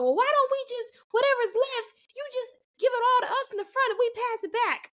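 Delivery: high-pitched mock complaining mom; arguing mom. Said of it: "some other mom arguing with the man".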